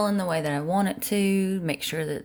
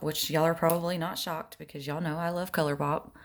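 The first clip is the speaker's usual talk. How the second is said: ordinary talk, nothing marked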